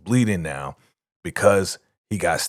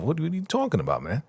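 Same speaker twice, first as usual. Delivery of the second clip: higher, fast, feigned confusion; mock disbelief; 7 semitones higher, 4 dB quieter, faster than usual. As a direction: act baffled, rush it out.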